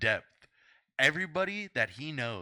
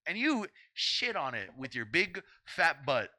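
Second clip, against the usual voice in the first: usual speaking voice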